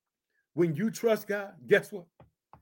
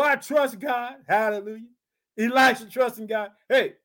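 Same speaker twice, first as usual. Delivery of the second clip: usual speaking voice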